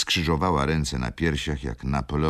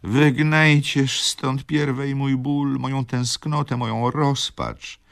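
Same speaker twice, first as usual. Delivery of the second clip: slow, higher, louder despairing cry; heartrending sobbing; 12 semitones higher, 5 dB louder than usual. Acted. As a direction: wail out her despair. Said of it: "szlochała rozdzierającym głosem".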